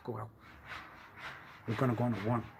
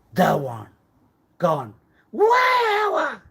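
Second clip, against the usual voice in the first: louder each word, ending in a high shout; building to a shout; 9 semitones higher, 10 dB louder, much slower than usual. Acted. Demanding where it went, rising shout. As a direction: climb to a cry on where.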